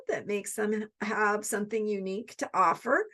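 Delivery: normal talking voice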